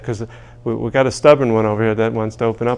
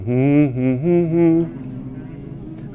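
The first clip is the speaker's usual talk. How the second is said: humming on request; 4 semitones higher, much slower than usual. Shown as a demonstration. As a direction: hum along as asked. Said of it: "Now I want you to hum".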